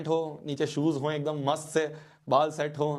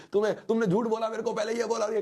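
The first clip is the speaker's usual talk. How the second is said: higher, imitating a furious little boy; half-hearted tantrum, pitched up; mock angry child yelling; 7 semitones higher than usual. Little boy cursing his elders in the street, mock tantrum. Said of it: "मैं गली में चिल्ला रहा हूँ".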